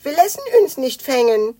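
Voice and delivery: high, loud amused dragon; Spekulatius. A narrator's reading. Dragon laughs off being caught, amused creature voice. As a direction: scoff in a high creature voice with twisted vowels. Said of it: "meinte Spekulatius lachend".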